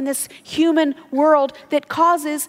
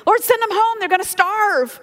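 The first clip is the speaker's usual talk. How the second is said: hyperventilating with worry, pitched up; panicky plea; 6 semitones higher than usual. Retold or imitated. Disciples begging Jesus to send crowds home, panicky and pitched up. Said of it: "disciples start hyperventilating".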